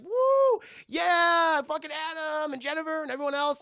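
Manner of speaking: high, loud whooping cheer; cheering crowd. Acted. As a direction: whoop it up for the winners. Crowd cheers the winning team.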